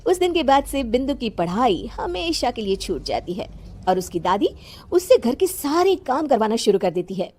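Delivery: usual speaking voice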